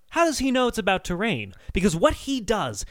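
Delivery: ordinary talk, nothing marked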